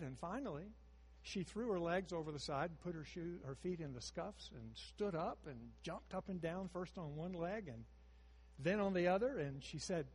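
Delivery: usual speaking voice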